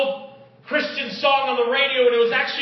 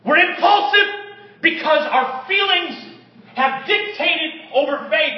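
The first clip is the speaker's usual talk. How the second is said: higher and louder punch of preaching; preaching burst; 6 semitones higher, 3 dB louder than usual. Acted. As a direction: hit it like a pulpit peak. Preaching climax.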